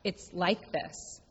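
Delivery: soft, slow refrain. Shown as a demonstration. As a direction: let it land quietly, slowly. Refrain in the sermon.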